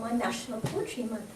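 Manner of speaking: usual speaking voice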